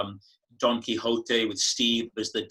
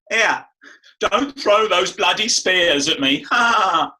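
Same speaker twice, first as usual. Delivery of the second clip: higher, louder Michael Caine in Zulu; Jagger doing Caine; 6 semitones higher, 8 dB louder, slower than usual. Retold or imitated. [name] mimicking Rob's Caine impression from Zulu, loud and high. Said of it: "doing an impression of me".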